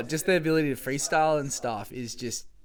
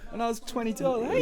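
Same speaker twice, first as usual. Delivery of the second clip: high-pitched voice; 8 semitones higher, much faster than usual. Retold or imitated. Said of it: "I still got a high-pitched voice".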